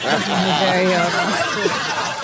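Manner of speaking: laughing through the words, slow on muscular; amused echo while laughing; real giggle while repeating the quote. Real, not acted. Echoing the line, amused.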